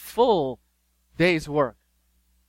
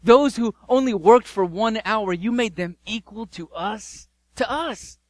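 indignant complaint; 5 semitones higher, 5 dB louder than usual. Acted. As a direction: protest like cheated workers. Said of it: "And they say this".

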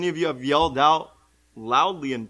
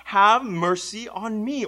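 loud, high, slow plea; yelled plea; 5 semitones higher, 7 dB louder, slower than usual. Acted. Blind beggar with his only chance to reach Jesus, yelled plea. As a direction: yell it out, drawing out each word. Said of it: "He yells out".

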